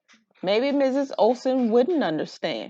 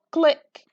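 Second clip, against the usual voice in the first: crisp little click, click; camera click sound; 8 semitones higher, 6 dB louder than usual. Acted. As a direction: mimic a toy camera clicking.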